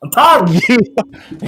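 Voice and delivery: exasperated. Real, not acted. Ranting at a commenter.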